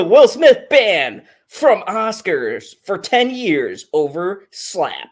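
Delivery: high, slow, hammed-up headline read; mock dramatic headline. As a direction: pitch it way up and stretch each phrase. Takes turn at dramatic headline.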